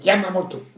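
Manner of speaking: high-pitched Yamamoto, leaning on MO; stress on third syllable. Shown as a demonstration. Contestant teaching the taxi call, Yamamoto stressed on its third syllable. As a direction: hit the MO in Yamamoto. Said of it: "Accentuer la troisième syllabe".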